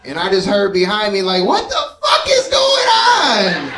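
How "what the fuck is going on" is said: high, drawn-out outraged shriek; slow, loud, very high-pitched scream; mock scream. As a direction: imitate a horrified passenger wailing at full volume. Voices passenger screaming behind him.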